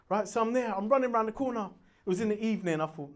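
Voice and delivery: ordinary talk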